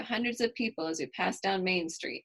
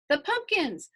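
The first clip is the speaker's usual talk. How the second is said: put-on high, excited little-girl squeal; bright child's squeal of joy; squealing with delight; 8 semitones higher, 4 dB louder than usual. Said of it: "I squeal".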